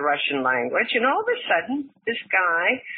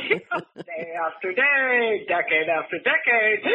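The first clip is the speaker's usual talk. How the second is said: words carried on a laugh; laughing through the memory of the bar; 3 semitones higher than usual. Real, not acted. Guest laughing as she describes the journalists' routine.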